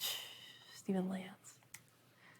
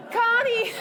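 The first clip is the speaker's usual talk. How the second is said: laughing joyful call; 12 semitones higher, slower than usual. Retold or imitated. Steven and Connie calling as they run to each other. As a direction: cry out with happy relief.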